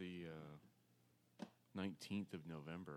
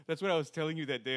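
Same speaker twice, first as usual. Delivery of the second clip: usual speaking voice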